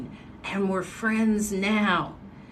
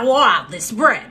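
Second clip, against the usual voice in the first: put-on loud New England accent, fading on the repeats; Northeast accent; 5 dB louder than usual. Woman imitating dad praising bread, loud Northeast accent. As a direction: burst out in a Boston-like accent, then tail off. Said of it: "like that type of accent".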